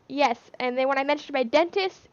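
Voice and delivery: usual speaking voice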